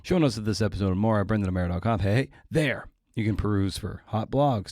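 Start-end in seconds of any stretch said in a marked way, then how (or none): none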